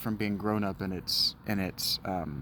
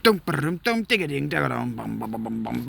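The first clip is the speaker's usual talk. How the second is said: humming battle music; 3 semitones higher than usual. Pretend. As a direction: hum the battle fanfare. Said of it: "battle music".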